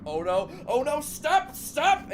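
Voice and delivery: high panicked squeals. Retold or imitated. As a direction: squeak out a frantic stop. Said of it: "you go like".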